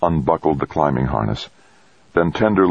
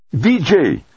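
high, urgent shout for a colleague; sudden shout; 14 semitones higher, 4 dB louder than usual. A narrator's reading. Astronaut calls the medic to the comm console, urgent shout. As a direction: shout the name to rouse a sleeper. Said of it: "shouted in a voice that shattered the sleepy silence".

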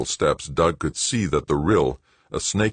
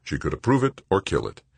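ordinary delivery

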